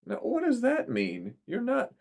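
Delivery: high, rising alarm; a worried mother pressing back. A narrator's reading. Mother voiced on the phone.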